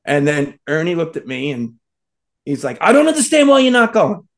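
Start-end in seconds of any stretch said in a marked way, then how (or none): 2.8-4.3 loud, high retold outburst; mock-exasperated shout; exasperated yelling. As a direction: re-enact yelling at a stubborn friend. Retold angry argument over the trip.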